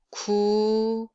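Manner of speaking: drawn-out plain Korean "Ku"; plain lax k. Shown as a demonstration. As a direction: say "Ku" relaxed and held out. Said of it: "let's just read each sound".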